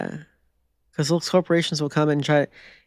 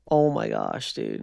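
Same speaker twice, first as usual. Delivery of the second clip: exasperated groan; 4 dB quieter, slower than usual. Real, not acted. Exasperated at suggestion.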